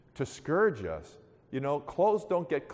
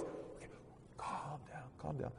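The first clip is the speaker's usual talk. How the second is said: very soft acted calming; lightly voiced deacon, hushed and soothing; 3 semitones higher, 17 dB quieter than usual. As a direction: quietly settle someone down. Deacon soothes the wailing woman.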